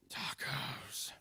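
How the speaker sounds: raspy, breathy whisper of a clown. Acted. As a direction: rasp the word out in a hoarse whisper. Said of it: "that's my attempt at raspy".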